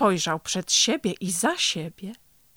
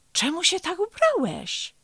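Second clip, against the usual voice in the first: high-pitched, astonished question; a rabbit's surprised query; puzzled surprise; 10 semitones higher than usual. A narrator's reading. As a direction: ask it in wonder, pitch jumping up. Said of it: "zdziwił się królik".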